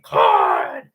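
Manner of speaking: deep, loud, throaty announcer cry; Kevin Harlan. Retold or imitated. Showing viewers the announcer's throaty call, deep bellow. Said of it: "like, his throat".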